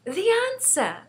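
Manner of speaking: put-on high-pitched, eager girl; child bursting with an idea; excited cry. A narrator's reading. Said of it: "cried Sophie".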